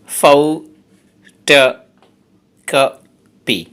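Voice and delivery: Photocopy pulled apart into slow, separate syllables; teacher breaking photocopy into its syllables. Shown as a demonstration. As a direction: spell out photocopy one syllable at a time, very slowly. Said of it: "এই ওয়ার্ডটাতে আছে চারটে syllable".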